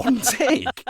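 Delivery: high, loud laughing disbelief; incredulous. Retold or imitated. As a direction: squeak it in mock shock through laughter. Mock shock at client's quick approval.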